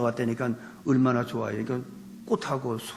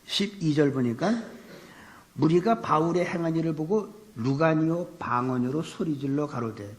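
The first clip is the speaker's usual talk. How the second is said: normal talking voice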